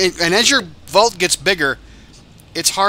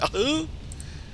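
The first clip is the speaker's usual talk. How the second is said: high giggle; 11 semitones higher, 9 dB quieter than usual. Pretend. Character laugh after voiced lines.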